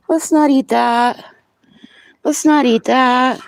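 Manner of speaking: high, slow sing-song scolding. Real, not acted. Stopping animal eating something.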